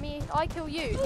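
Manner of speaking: ordinary delivery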